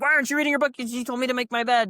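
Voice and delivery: high, loud, bewildered complaint; mock confused protest. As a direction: squeal it like a baffled recruit. Recruit protesting confused in boot camp.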